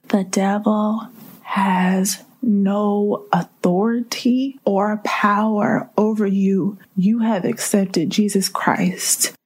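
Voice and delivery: slow, weighty words, each one landed; slow declaration. Real, not acted. Preacher assuring the church of their power, slow and weighty. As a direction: lay it down like a verdict. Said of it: "slow emphatic declaration".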